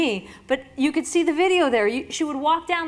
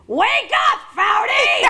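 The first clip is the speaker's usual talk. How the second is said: higher, louder mock shout to snap her out of it; Billie Jean's wake-up yell; 9 semitones higher, 7 dB louder, slower than usual. Billie Jean yelling at Foudy to wake up, loud mock shout. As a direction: bark it like a coach rousing a player. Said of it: "And she goes".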